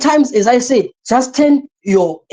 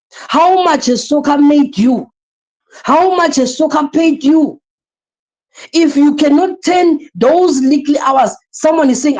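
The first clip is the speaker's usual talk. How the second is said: higher, pressing rhetorical question; fired-up challenge; 6 semitones higher than usual. Real, not acted. Teacher challenges students about soccer Saturdays, fired up. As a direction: push them hard, a bit higher and insistent.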